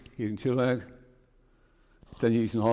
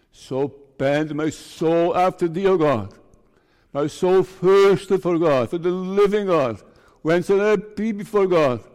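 scripture recitation; 4 semitones higher, 7 dB louder than usual. Acted. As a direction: proclaim the psalm like a sermon. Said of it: "It's Psalm forty-two".

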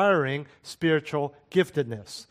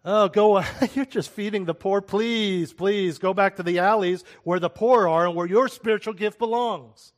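higher and louder, laughing off the server; dismissive scoff; 4 semitones higher, 4 dB louder than usual. Retold or imitated. Tongues speaker sneering at the server who feeds the poor, higher, louder and mocking. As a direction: wave him away with a mocking laugh.

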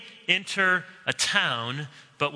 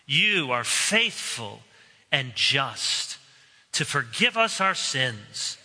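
closing prayer; 3 semitones lower than usual. Acted. Pastor leads the congregation in a closing prayer, earnest. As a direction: pray it steadily and humbly.

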